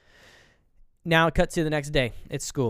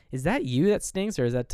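higher-pitched teasing question; mock needling mom; 3 semitones higher than usual. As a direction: imitate a mom's snide jab in a raised pitch. Mother's jab at a boy.

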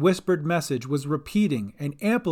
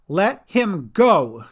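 slow, loud, higher command; 4 semitones higher, 6 dB louder, much slower than usual. A narrator's reading. Sister Cat confronts Dolly from deck, commanding. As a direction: order it, stretching every word. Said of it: "commanded Sister Cat".

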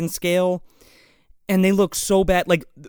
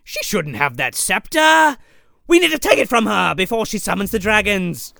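hammed-up protest; 3 semitones higher than usual. A plotter protesting about the Empress's scepter, hammed-up alarm. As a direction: play the scandalized schemer, overdone. Acting out a villain's urgent protest.

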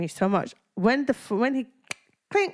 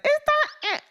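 much higher, gushing mock of Mike; 3 dB louder than usual. Host retells Mike's phone call, mocking him in a very high voice. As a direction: send up his too-easy acceptance. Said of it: "I got really stressed when Mike was like".

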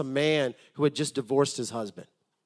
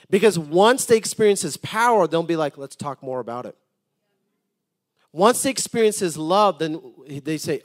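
louder fervent pulpit delivery; preaching; 2 semitones higher, 8 dB louder than usual. Acted. Pastor urging the congregation that people hunger for Jesus, fervent preaching. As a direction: drive the point home to the congregation.

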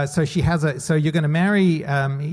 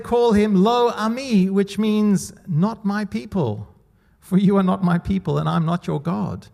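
scripture reading; 6 semitones higher, 3 dB louder than usual. Acted. Reads God's words from Hosea.

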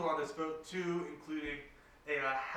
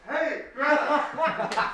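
high, long-drawn call breaking into laughter; mock yell; 10 semitones higher, 9 dB louder, much slower than usual. [name] hails Greta without actually yelling, stretched mock yell. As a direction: stretch it out as if hailing from afar. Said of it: "sound like I'm yelling without actually yelling".

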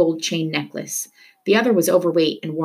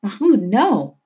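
mother crying out; 4 semitones higher, 4 dB louder, slower than usual. Acted. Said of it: "his mother cried".

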